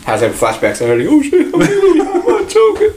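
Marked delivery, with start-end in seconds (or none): 1.1-2.9 squeaky high laughing; laughing fit. Real, not acted. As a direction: laugh till choking, very high.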